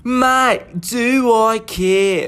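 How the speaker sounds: a laid-back Australian accent; easygoing Aussie accent. Shown as a demonstration.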